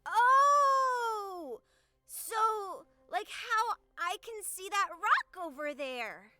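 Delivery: put-on loud, drawn-out childlike realisation; excited kid. Kid grasping the new word, excited shout. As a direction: exclaim with sudden delighted understanding.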